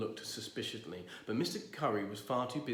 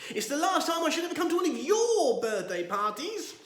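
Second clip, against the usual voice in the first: outraged; 8 dB louder than usual. Acted. Outraged exit.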